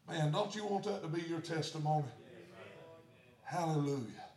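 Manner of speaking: normal talking voice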